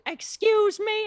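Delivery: very high, drawn-out offended cry; loud, hammed-up indignant question; mock indignation. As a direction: squeal it slowly as an affronted woman. Voicing woman challenged over parking.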